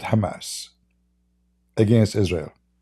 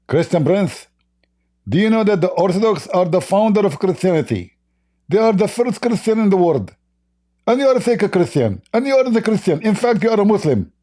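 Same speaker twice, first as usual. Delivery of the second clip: mock Orthodox priest; 9 semitones higher than usual. Mimicking Orthodox priest scolding him.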